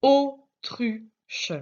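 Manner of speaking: "Autruche" in separated syllables, the first high and loud; découpé en syllabes. Shown as a demonstration. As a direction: break "Autruche" into au, tru, che with gaps. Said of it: "J'entends le O au début du mot". (« Autruche » détaché syllabe par syllabe, début appuyé)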